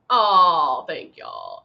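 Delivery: ordinary talk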